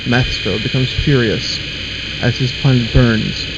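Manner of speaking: ordinary delivery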